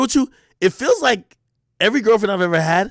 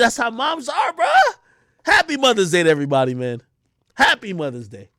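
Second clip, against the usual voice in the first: ordinary talk, nothing marked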